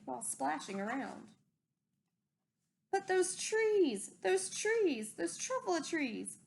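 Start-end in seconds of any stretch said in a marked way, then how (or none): 2.9-6.4 higher, slow, marvelling at the trees; awed wonder. A narrator's reading. Storyteller recalls first seeing the trees, in awe. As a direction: breathe out the wonder of it.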